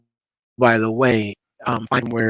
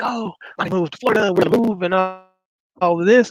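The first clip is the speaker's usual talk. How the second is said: lightly imitated child, higher pitch; child's announcement; 8 semitones higher than usual. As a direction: retell a kid's big news in a raised voice. Retelling son announcing a move.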